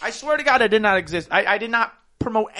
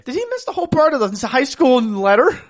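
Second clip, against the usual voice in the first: ordinary talk, nothing marked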